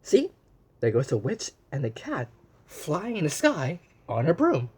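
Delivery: ordinary delivery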